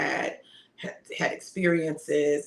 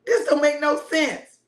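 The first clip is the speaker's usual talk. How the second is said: higher, slow, shouted complaint; hammed-up daughter yelling at mom; mock teen outburst; 9 semitones higher, 5 dB louder than usual. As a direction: play the rebellious kid yelling. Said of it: "I was like".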